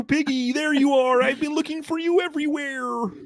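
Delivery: high-pitched puppet voice; Kermit impression. Co-host playing Kermit to tease the Miss Piggy look, high and puppet-like. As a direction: call out like Kermit finding Piggy. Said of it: "Miss Piggy".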